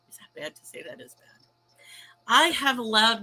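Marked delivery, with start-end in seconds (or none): 0.1-1.3 guilty whispered aside. Real, not acted. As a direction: breathe it out as a sheepish confession. Guilty aside after admitting bad.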